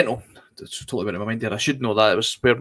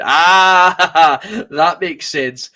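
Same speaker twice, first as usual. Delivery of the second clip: amused shopkeeper laugh; 4 semitones higher, 6 dB louder, much slower than usual. Retold or imitated. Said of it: "And he went".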